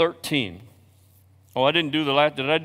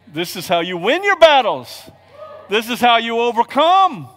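rising higher and louder; preacher building to the victory line; building climax; 7 semitones higher, 7 dB louder than usual. Real, not acted. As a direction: climb to a triumphant peak.

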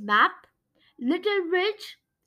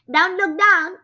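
louder urgent warning; 4 semitones higher, 7 dB louder than usual. A narrator's reading. Frog warning the trembling mouse, urgent and louder. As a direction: caution him sharply, louder. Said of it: "Scribble Hopper warned".

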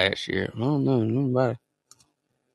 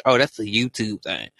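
normal talking voice

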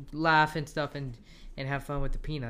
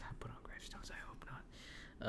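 whispering; 17 dB quieter than usual. Real, not acted. Laughing correction of own slip.

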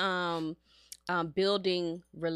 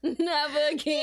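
"never again" through laughter, higher; giggly, raised-pitch echo; laughing repeat; 5 semitones higher than usual. Real, not acted. Repeats punchline while laughing.

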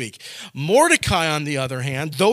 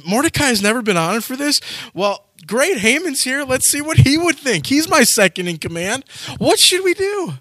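lightly voiced king, pitched higher and higher; a bored king musing aloud, rising into a high question; sleepless king; 6 semitones higher than usual. Preacher voicing the king pondering Haman, mock curious.

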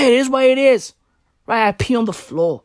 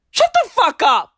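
high-pitched mock-angry outburst; Johnny Depp; 9 semitones higher, 3 dB louder than usual. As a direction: snap it at the singer, pitched up high. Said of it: "That's Johnny Depp".

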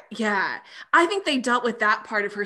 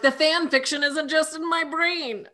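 higher, gleeful realisation; excited outburst; 7 semitones higher than usual. Retold or imitated. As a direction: blurt it out, thrilled. Excited retold reaction.

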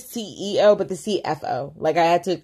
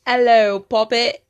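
louder, higher fake British greeting; mock British accent; 5 semitones higher, 7 dB louder, slower than usual. Cohost trying her British accent back. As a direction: answer in a playful British accent. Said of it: "three things in a British accent".

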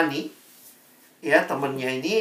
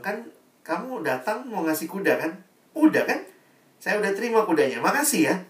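higher, fast, brushing-off king; impatient king; 7 semitones higher than usual. Acted. King wonders why the breeder still waits, impatient. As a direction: hurry him out the door.

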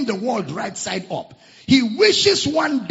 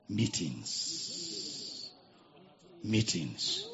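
hushed emphasis; 8 semitones lower, 11 dB quieter, much slower than usual. Real, not acted.

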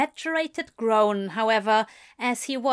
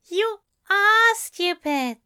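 slow, higher, fond teasing; 10 semitones higher, 5 dB louder, slower than usual. A narrator's reading. The angel teases Crowley, fond and slow. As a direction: tease him lovingly. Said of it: "Aziraphale said fondly".

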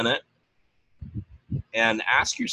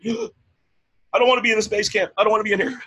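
high, whiny mock complaint; whiny overwhelmed newbie; 10 semitones higher, 4 dB louder than usual. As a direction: play a reluctant new coach begging out. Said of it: "it had to be like".

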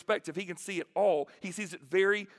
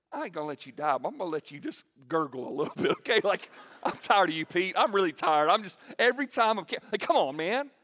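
exasperated Jesus; 7 dB louder than usual. Retold or imitated. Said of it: "I'm gonna be like".

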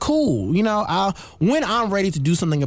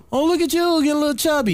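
high, teasing mock aunt voice; sing-song poke about weight; teasing relative; 9 semitones higher, faster than usual. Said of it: "it's the way they say it".

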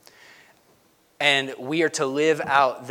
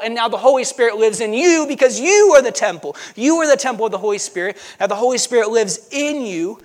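higher, louder, driving sermon delivery; impassioned preaching; 6 semitones higher, 6 dB louder than usual. Real, not acted. Preacher telling the congregation God's presence is available, impassioned. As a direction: preach it out with big stresses on key words. Preacher builds to full force.

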